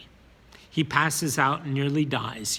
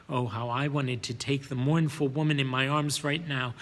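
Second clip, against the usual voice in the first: ordinary delivery, nothing marked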